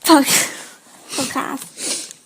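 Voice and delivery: breaks into a laugh on the last word; laughing through it. Real, not acted. Co-host cracking up while finishing the intro, laughing. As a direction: giggles out the word podcast.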